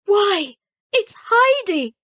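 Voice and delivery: high, loud burst of surprise; surprised exclaim. A narrator's reading. Peter's mother seeing Heidi return, high surprised exclamation. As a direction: gasp out the name in astonishment. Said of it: "she exclaimed".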